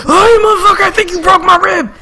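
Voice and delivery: high, loud yelp of pain and outrage; a dad just punched in the ribs, yelping high and loud. Retold or imitated. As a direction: squeal like a winded dad clutching his ribs.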